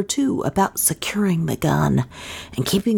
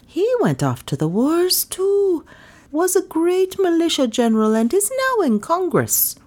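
much higher, piping villager voice; high-voiced villager; 10 semitones higher than usual. A narrator's reading. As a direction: answer in a high character voice.

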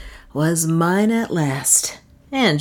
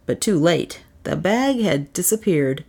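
ordinary delivery

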